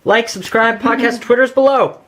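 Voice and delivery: loud high announcer outro; excited outro. Real, not acted. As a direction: rattle off the outro loudly. Fast outro call.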